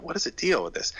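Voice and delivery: host puzzled by a guest's trips. Retold or imitated. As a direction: wonder aloud, baffled. Retold puzzlement.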